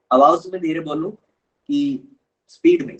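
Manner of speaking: the question asked at half speed; deliberately slow rerun of the question. Shown as a demonstration. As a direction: stretch every word out slowly, as if testing the request. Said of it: "धीरे बोले".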